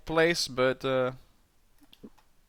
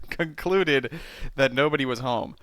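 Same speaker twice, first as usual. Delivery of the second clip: normal talking voice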